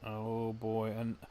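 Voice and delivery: ordinary delivery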